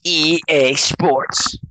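drawn-out game startup voice-over; game intro announcer. Retold or imitated. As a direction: intone it slowly like a game intro. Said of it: "and it'd go".

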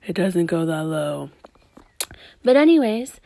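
ordinary delivery

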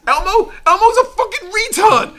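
high, loud mock outrage; mock furious Big Bird. Big Bird ranting at his co-stars, high and loud. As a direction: rant like a jealous star.

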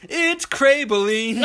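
slow, drawn-out high mocking word; slow jeering pun; punchline taunt. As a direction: stretch it out to land the insult. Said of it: "he just goes".

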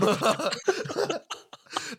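a long high laugh. Real, not acted. Amused by his friend's joke, a long high laugh. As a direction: burst out laughing.

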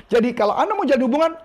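ordinary talk

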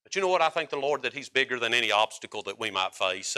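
normal talking voice

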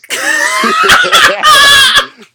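big high-pitched cackle of laughter; howling laugh; loud high laugh. Real, not acted.

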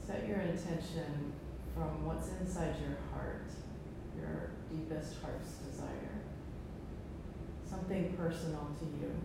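soft, calm breathing guidance; guided meditation. Acted. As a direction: ease them into stillness. Closing meditation after om chanting.

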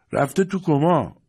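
head nurse loudly calling out the patient's state; loud announcement. A narrator's reading. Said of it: "بلند اعلام کرد".

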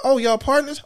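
high, knowing tease; high mock girl. Girl asking if the two men are partners, high and teasing. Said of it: "She like".

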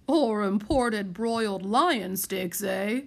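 plain reading voice

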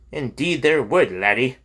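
a hearty Scottish host welcoming a stranger in a Scots brogue. Acted. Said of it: "answered in a Scots brogue".

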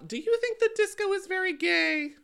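playful high question. Real, not acted. Host putting disco question to co-host, playfully high.

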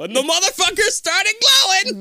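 loud, high burst of excitement; excited. Real, not acted. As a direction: shout the payoff in delight. Excited payoff of the story.

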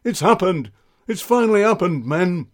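rally the troops in a flap; flustered sergeant. Acted. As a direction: sergeant raising the alarm, voice up. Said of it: "entered in a flap".

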